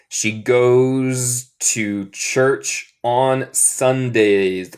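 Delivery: very slow, word-by-word reading; repeat-after-me model. Shown as a demonstration. Teacher has students repeat after them, very slow model. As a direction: spell out the sentence slowly, pausing between words. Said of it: "Repiten después de mí".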